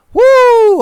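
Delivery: high, loud woo; excited whoop. Real, not acted. Reacting to surprising weddings on the show, excited whoop. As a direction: let out a sudden whoop. Exclaims over his own surprise.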